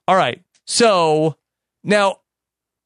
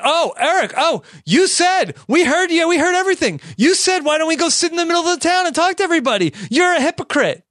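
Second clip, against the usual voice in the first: high-pitched, indignant retort; mock accusing kids; 10 semitones higher, much faster than usual. Kids confronting Eric over his own suggestion, mock indignant. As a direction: pile on the accusations in a high, rushed whine of protest.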